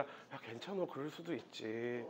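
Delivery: very soft, low, coaxing voice; soothing boyfriend. Pretend. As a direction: gently placate a crying girlfriend, barely above a murmur. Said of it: "남자가 되려 화내는 게 아니라".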